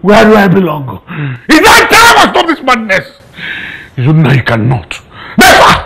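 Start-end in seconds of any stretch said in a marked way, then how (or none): 1.5-3.2 high, raised-voice declaration; furious resolve. Acted. Uncle vowing to end the madness, furious resolve. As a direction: burst out with the vow.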